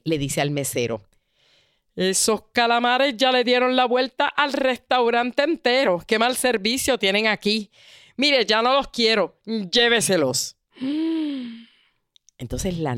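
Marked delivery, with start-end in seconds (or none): 1.9-10.6 rude complaint. Retold or imitated. Diner scolds the waiter over calamari, mock indignant. 10.8-11.5 a sharp, high, breathy gasp of shock. Retold or imitated. Narrator reacts to the rude diner, gasping.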